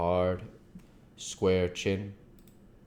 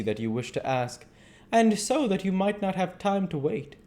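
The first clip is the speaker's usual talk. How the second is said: put-on higher, louder voice for a polite young woman; polite young wife; 10 semitones higher, 5 dB louder than usual. Mina to the doctor, offering her hand, polite and gracious. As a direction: answer with gracious warmth.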